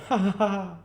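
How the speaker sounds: high, loud scornful ha-ha; mocking laugh. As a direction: cackle at his own cruel joke. Gentleman laughs at his joke.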